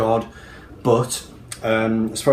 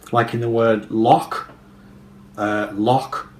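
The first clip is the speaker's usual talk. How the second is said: ordinary talk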